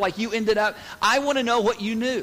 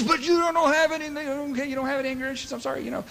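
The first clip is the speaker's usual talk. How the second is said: mock defensive denial; 5 semitones higher, faster than usual. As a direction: play the offended denier who backs off. Mocking denial of anger issues.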